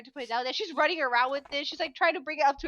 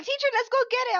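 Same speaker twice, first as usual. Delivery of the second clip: high, louder mimicked kids; children urging teacher; 11 semitones higher, 5 dB louder, faster than usual. Teacher retells pupils urging her toward a cockroach, imitated kids. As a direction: pipe up like excited pupils, higher and louder. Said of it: "And they're like".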